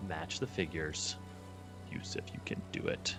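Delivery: usual speaking voice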